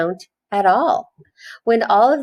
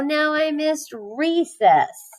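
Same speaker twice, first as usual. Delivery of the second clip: disappointed Joe; 9 semitones higher, slower than usual. A narrator's reading. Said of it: "said Joe".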